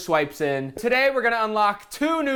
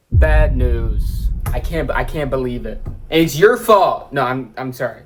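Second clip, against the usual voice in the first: mock outrage; 5 semitones lower, 5 dB louder than usual. Mock meltdown over being banned.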